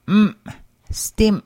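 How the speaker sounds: "stim" with the m cut short; abruptly ended m. Shown as a demonstration. Teacher modelling glottal M for learners, cut-off m. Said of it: "pronounced as an abruptly ended M".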